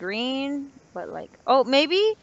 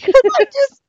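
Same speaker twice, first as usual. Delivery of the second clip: laughing; 10 semitones higher, 5 dB louder than usual. Real, not acted. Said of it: "I sound like a goat".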